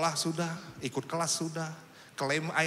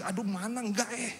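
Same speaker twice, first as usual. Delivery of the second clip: dropped-volume aside of disbelief; doubting inner voice; 6 semitones higher than usual. Retold or imitated. Pastor's hidden thought after preaching, doubting inner voice. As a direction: grumble it privately, higher than usual. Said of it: "dalam hati".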